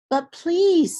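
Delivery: put-on a small boy begging harder; hungry boy pleading with Manyara. Hungry boy begs again.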